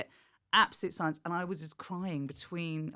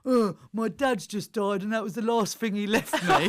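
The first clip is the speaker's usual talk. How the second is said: mock wounded reply; 5 semitones higher, 6 dB louder than usual. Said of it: "he went".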